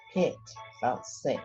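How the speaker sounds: plain reading voice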